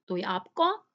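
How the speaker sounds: normal talking voice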